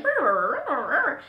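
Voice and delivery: playful nonsense babbling sound; mock babble. Showing how she rambled on the call, mock babble. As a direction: imitate rambling with a brr noise. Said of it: "it was like".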